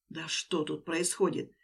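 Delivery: ordinary delivery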